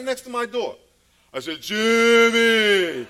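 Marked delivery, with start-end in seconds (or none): 1.6-3.1 very loud, higher, long-drawn holler; scare yell. Retold or imitated. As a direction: startle a child with the name, full blast. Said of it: "then I'll scare him".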